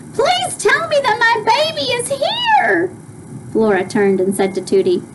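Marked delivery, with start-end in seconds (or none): 0.1-2.9 very high pleading mother; mother begging to know her baby is here; frantic plea. Acted. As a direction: beg desperately. Mother pleading, read aloud.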